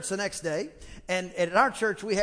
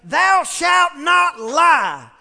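high, loud, slow nasal pulpit thunder; nasally preacher shout; 8 semitones higher, 12 dB louder, much slower than usual. Retold or imitated. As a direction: boom it like a nasal old-time preacher. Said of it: "he kinda had this nasally talk like this".

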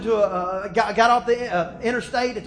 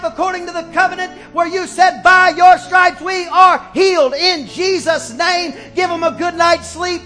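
put-on loud, high preaching prayer over the children; a pastor's declaring prayer, raised and strong; fervent prayer; 6 semitones higher, 7 dB louder than usual. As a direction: pray it out with full conviction.

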